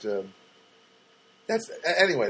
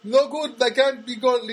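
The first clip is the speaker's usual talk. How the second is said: put-on high, broken English with a Spanish accent; exaggerated Spanish-accented patter; imitated Spanish accent; 6 semitones higher, 4 dB louder than usual. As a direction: mimic a Spanish speaker struggling with English. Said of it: "that's how he talks".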